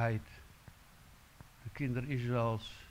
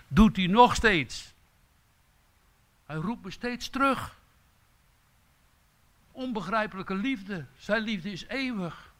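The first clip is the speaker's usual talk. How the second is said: veel hoger en luider, ontroerd over Gods liefde; vervoerde preek; 8 semitones higher, 8 dB louder than usual. Acted. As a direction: til je stem op in verwondering. Preacher swells on God's love. (hoog en luid predikend over de eeuwige liefde)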